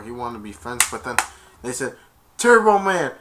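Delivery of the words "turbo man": raised, loud call; excited shout. Retold or imitated. Shoppers hearing about more Turbo Man dolls, excited shout. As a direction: call the toy's name out. Crowd calling out the toy.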